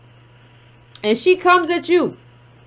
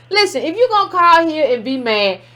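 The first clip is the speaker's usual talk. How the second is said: ordinary talk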